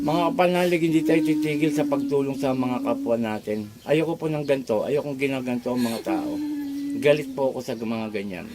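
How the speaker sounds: low, soft address to followers; hushed, low-pitched appeal; earnest aside. Real, not acted. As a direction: drop low and quiet, confiding in the faithful. Healer turns aside to address followers.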